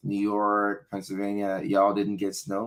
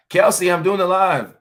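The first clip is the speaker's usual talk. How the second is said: raised, high-pitched call to someone; 10 semitones higher, 9 dB louder than usual. Real, not acted. Telling Kelsey the livestream is on, calling out. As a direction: call out loudly, pitched well up.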